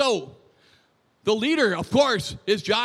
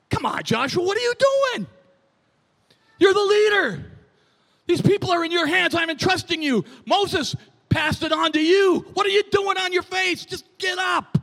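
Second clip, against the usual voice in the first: high, loud, incredulous scolding; exasperated rebuke; 8 semitones higher, 4 dB louder than usual. Acted. Preacher voices God rebuking the leader Joshua, exasperated. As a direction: scold him onto his feet, forceful and raised.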